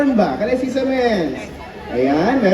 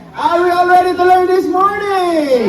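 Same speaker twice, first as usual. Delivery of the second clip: higher, louder rallying shout; louder repeat call; 7 semitones higher than usual. Real, not acted. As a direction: push harder so everyone answers. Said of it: "Ulitin nga natin".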